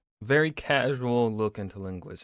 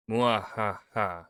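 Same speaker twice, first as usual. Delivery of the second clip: slow evil laugh; 2 semitones lower, slower than usual. Pretend. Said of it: "Doing the evil".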